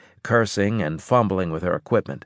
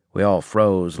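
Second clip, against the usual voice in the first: ordinary delivery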